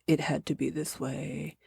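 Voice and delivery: lightly mocked cheesy line; cheesy hero line. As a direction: deliver it like a cheesy action hero. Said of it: "some cheesy line".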